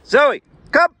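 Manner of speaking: high, loud recall call; calling a dog. Real, not acted. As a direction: call her across the field.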